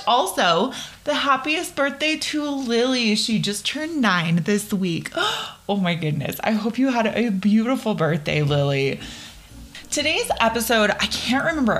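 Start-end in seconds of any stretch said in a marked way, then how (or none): 5.2-5.6 excited gasp. Real, not acted. Birthday news for a listener.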